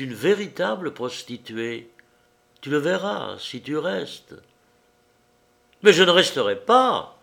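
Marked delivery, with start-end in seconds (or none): 5.8-7.2 loud, higher cry through gritted teeth; loud furious cry through gritted teeth; shout through clenched teeth. A narrator's reading. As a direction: yell it, jaw clenched. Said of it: "cria-t-il, les dents serrées".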